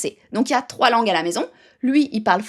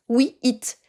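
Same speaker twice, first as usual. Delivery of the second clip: put-on slow, careful we eat with a French accent; English-speaking dad. English-speaking dad telling baby it's mealtime, slow and French-accented. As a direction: spell out we eat slowly, as the dad would say it. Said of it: "Et si papa parle anglais".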